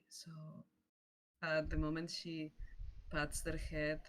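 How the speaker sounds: ordinary talk, nothing marked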